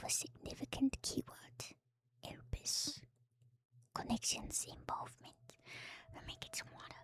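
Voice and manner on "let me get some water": ordinary delivery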